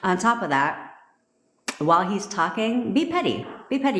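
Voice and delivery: normal talking voice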